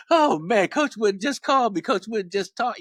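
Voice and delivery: high, loud, starstruck rush; giddy excitement. Retold or imitated. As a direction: blurt it out like a thrilled teen. Reliving the thrill of the call.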